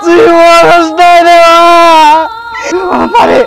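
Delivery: very slow, sobbing shouted denial; drawn-out wail. Acted. Son refusing to accept his mother's death, slow sobbing wail. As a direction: stretch every word out through the sobs.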